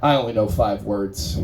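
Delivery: low dopey character voice; dim guy voice. Acted. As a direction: play a man who knows five words. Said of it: "this is a guy with a really, really limited vocabulary".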